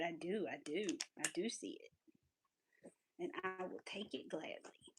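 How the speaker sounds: whispered, slow dog's voice; a sweet dog politely answering; voicing the dog. Answering for her dog about the Kong ball, whispered and slow. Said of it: "he said".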